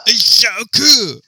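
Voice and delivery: very high, loud, drawn-out mock host; hammed-up, squealed enthusiasm; Rick Steves. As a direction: gush slowly like a goofy travel presenter. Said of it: "sounds like a guy who talks like this".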